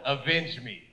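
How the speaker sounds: mock grave demand; stern wife. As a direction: order revenge like a cold queen. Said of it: "She looks at him like".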